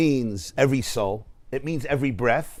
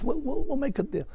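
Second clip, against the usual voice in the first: high, softer imitation of a reassuring doctor; placating doctor; 10 semitones higher, 6 dB quieter than usual. Doctor offering a crying patient a payment plan, placating. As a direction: retell it as coaxing, pitched well up.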